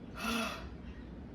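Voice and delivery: long grieving sigh; heavy sigh. Real, not acted. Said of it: "I feel it from here".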